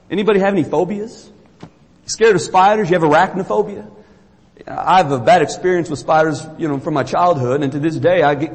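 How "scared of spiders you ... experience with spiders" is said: ordinary talk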